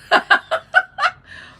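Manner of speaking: high, loud squeal of laughter rising. Real, not acted. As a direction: laugh in delighted surprise. Laughs at viewer comment.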